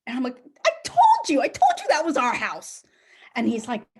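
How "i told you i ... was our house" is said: high, loud triumphant crow; gloating I told you. Retold or imitated. Wife gloating to husband, loud high triumphant crow. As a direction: rub it in, delighted.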